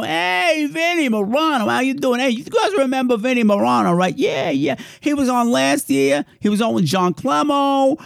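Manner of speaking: high, bright-eyed hype for a donor; high excited host greeting; excited shout-out. Real, not acted. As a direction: hype up Vinny like a game show host.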